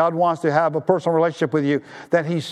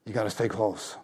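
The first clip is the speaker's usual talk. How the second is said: softer, hushed echo of the plea; softer repeat; 6 semitones lower, 8 dB quieter than usual. Real, not acted. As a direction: bring it down, intimate and quieter.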